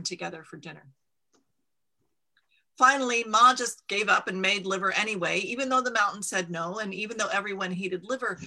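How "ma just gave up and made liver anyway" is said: usual speaking voice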